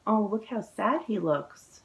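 usual speaking voice

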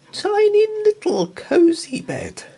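high, loud squeaky tiny-thing voice. A narrator's reading. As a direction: make it sound tiny and cute. Narrator voices tiny bed.

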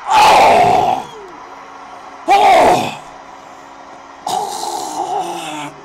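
very high, loud cries of oh; three very high, loud howls of oh; huge yell. Real, not acted. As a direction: scream at the goal like it broke your heart. Reacting to a goal, yelling.

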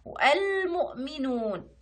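والمؤمنون slow, with the ل held; sounded lunar lam. Shown as a demonstration. Teacher giving another lunar lam, والمؤمنون with ل stretched. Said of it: "حتى هي لام قمرية".

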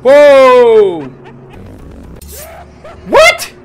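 loud high startled shout; startled yell. Real, not acted. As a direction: yelp in alarm.

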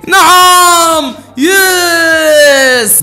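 very high, loud calls; loud drawn-out triumphant yell; urgent hype shout. Real, not acted. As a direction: shout the timing at the screen. Timing the shot.

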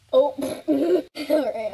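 laughing through the words; slow words broken up by real laughter; laugh attack. Real, not acted. Vlogger recovering from a laugh attack, laughing as she talks. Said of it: "I'm back from having a laugh attack".

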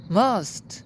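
ordinary talk, nothing marked

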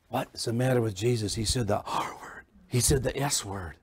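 low, hushed mock horror, dropping to a whisper on the last line; mock scandalized hush. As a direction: feign shock as if telling a scandalous secret. Mock scandal over Jesus' words.

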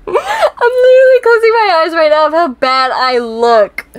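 loud, high-pitched squeal through laughter; shrieky embarrassed laughing, easing down at the end; laughing mortification. Real, not acted. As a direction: wail it while cracking up. Laughing in horror at own look.

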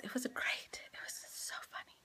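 hushed fan praise, fading away; soft whispered aside; whispered gush. Real, not acted. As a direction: whisper it like a secret delight. Gushing aside about funny show.

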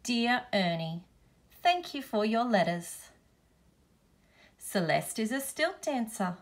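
usual speaking voice

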